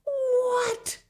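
very high, loud shocked yelp; can't believe what he heard; shrill outraged disbelief. Acted. Insult to his mother.

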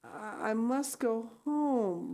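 slow, high, dazed insistence; stupid but determined. Acted. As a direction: repeat it dully, dragging each word. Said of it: "stupidly but determinedly".

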